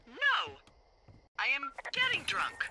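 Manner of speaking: high, emphatic denial; tipsy mock indignation. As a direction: protest playfully, pitch leaping up. Denies being drunk, getting drunk.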